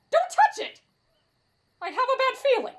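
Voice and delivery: high-pitched anxious cry; alarmed warning. Acted. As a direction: warn them off in fright. Frightened warning about fog.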